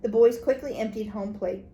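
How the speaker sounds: ordinary delivery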